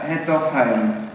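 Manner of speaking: usual speaking voice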